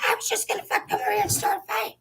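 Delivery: squeaky high mock of the ex. Ex-partner mocked in a high squeak. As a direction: pitch it way up to mock her. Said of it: "She's like".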